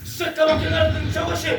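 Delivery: loud, high panicked calls; alarmed shouting. Acted. Cannon fire falling on fortress.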